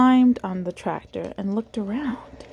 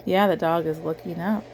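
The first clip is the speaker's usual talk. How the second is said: ordinary delivery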